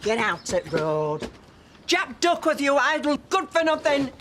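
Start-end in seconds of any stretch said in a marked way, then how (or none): 1.9-4.1 higher, raised scolding yell; scolding call. Acted. Wife shouting for idle husband, scolding yell. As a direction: call him out as a layabout.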